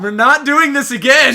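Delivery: loud exasperated protest; shouting. Real, not acted. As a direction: yell it in protest. Protesting the count bit.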